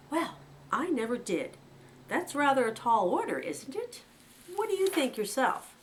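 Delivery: high, indulgent understanding tone; extremely understanding. A narrator's reading. Chief rabbit humoring Fiver's wild request, indulgent. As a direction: humor the youngster kindly. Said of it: "in an extremely understanding voice".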